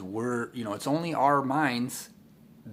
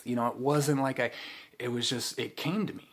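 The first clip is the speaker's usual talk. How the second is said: usual speaking voice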